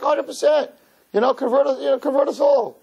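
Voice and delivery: high-pitched, over-keen retold agreement; hammed-up enthusiasm, pitched high; mock eagerness. Mock-eager reply to Howie's question.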